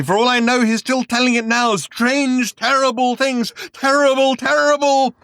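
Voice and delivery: high, loud terrified scream. Acted. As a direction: scream out the horror. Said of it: "he screamed".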